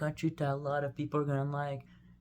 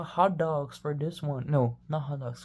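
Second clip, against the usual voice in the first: normal talking voice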